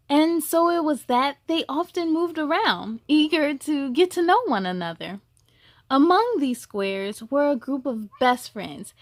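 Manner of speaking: gentle, measured storybook reading; story narration. A narrator's reading. Reading a picture book aloud to young listeners, gentle storytelling. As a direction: read it like a bedtime story to kids.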